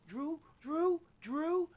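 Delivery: high, loud mock whine of the kid blurting "Drew, Drew, Drew"; imitating a kid caught out, high and loud; panicky kid. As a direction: ham up a scared kid squealing "Drew". Retold kid naming the thief.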